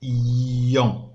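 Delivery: إيون said slowly and clearly, each sound apart; slow model word. Shown as a demonstration. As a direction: pronounce إيون slowly for the class. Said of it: "هذه إيون".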